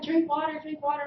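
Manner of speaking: high, loud repeated order; urgent mom. Retold or imitated. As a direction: mom urging her choking daughter, urgent and loud. Mom urging choking child.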